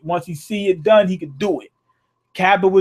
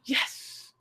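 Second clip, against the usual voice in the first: whispered yes; 15 dB quieter than usual. Retold or imitated. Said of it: "He was like".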